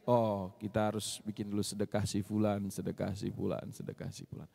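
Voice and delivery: low voice fading to near silence, listing to himself; fading mutter. Retold or imitated. Muttering charity plans to oneself. (bergumam pelan makin lama makin hilang)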